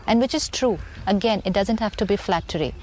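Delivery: ordinary talk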